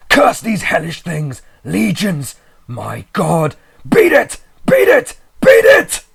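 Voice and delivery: high, loud, building shriek; horrified shriek. A narrator's reading. Friend shrieking last warning as the things take him. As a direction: shriek in ultimate horror. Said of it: "rose to a shriek fraught with all the horror".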